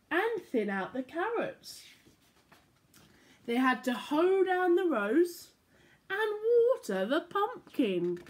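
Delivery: bright, lilting storybook voice; reading to children. A narrator's reading. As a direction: read it like bedtime story time for little ones. Reading picture book aloud.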